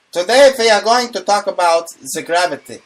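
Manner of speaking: hammed-up German doctor voice. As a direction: lecture like a mock German professor. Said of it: "do it in the German voice".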